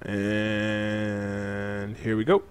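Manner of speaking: long, stretched-out words, softly; drawn-out lead-in. Real, not acted. Player starting the boss fight, drawn-out lead-in. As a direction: draw out the first word in anticipation.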